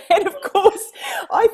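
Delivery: laughing high and loud; words spilling out through laughter. Real, not acted. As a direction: still laughing at the pilot's answer. Laughing at the pilot's answer.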